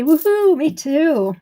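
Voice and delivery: high, loud cheer; a bright little whoop of joy; excited woohoo. Real, not acted. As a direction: cheer it out with delight. Cheering for next Friday.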